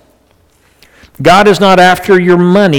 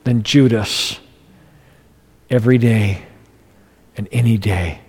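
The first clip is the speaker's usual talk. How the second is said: softer, lower heartfelt words; quiet prayer; 6 semitones lower, 7 dB quieter than usual. Retold or imitated. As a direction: say it like a private prayer. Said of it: "And I'm going".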